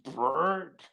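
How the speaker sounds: high, loud rolled brrrt; imitated trick noise. Recalling a midair skate trick, imitated brrrt noise.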